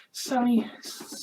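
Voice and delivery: usual speaking voice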